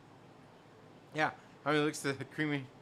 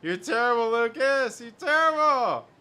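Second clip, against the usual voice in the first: rising laughing scold, louder each time; mock outrage; 7 semitones higher, 6 dB louder than usual. Real, not acted. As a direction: build to a loud playful yell.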